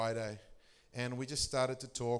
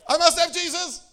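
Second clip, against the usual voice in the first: much higher, much louder, laughing hammed-up cry; mock desperate outburst; 15 dB louder than usual. Pastor mocking the stranger's sudden outburst, laughing. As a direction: blurt it out through laughter. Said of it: "Holy Ghost Tourette's".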